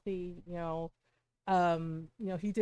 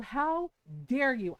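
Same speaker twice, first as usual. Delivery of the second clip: higher, louder, drawn-out outrage; indignant raised voice, short of a full scream; retold yell; 5 semitones higher, 5 dB louder, slower than usual. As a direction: re-enact an outraged yell, stretching the words slowly. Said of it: "Because I yelled".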